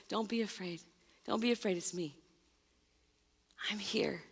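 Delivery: calm them with a hushed, tender voice; softly reassuring frightened friends in a storm; tender reassurance. Acted. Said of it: "And Jesus yells out and says".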